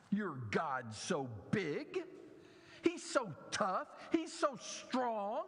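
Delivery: mocking taunt. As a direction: goad him to prove it, voice climbing. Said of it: "those who stood at the foot of the cross and said".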